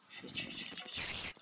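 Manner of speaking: repeated voiceless whispered curse; panicked whisper. Real, not acted. Player who has just spotted a spider, panicked whisper. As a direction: whisper it urgently under your breath.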